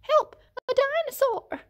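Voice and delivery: high panicky mock cry for help; frightened cry. Doctor hiding behind the nurse, high panicky yelp. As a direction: squeal it like a scared doctor.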